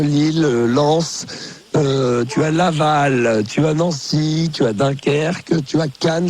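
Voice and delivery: each city drawn out slowly; slow listing. Real, not acted. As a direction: spell out the list slowly.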